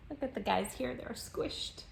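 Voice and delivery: hushed amused whisper. A narrator's reading. Reader pointing at the squished animals, whispering. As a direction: whisper it over the picture.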